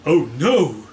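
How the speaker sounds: loud gasped exclamation; gasping. Acted. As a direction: gasp it out in dismay. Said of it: "gasped Mister Body".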